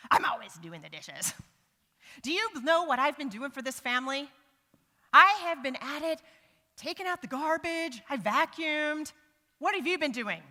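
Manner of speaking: loud, indignant defensive rant; defensive outburst. Shown as a demonstration. A spouse snapping back when asked to do chores. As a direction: push back hard and loudly. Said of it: "This is defensiveness".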